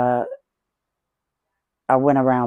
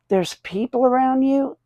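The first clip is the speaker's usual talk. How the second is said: incredulous; 10 semitones higher than usual. Retold or imitated. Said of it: "I said".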